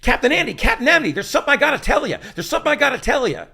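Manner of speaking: high, loud, fast urgent delivery; mock line reading. Star demonstrating the line he wants, high and loud. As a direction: blurt it like a melodrama.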